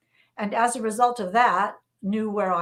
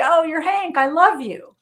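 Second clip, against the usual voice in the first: gushing fan; 6 semitones higher, 6 dB louder than usual. Retold or imitated. Fan greeting him on street.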